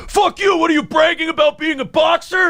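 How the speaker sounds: high, shouted heckler voice; angry heckler shout. Retold or imitated. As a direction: yell it like an outraged heckler. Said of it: "They're like".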